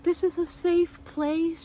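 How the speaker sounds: high, slow, soothing imitation; reassuring nurse. Nurse to crying patient, high slow reassurance.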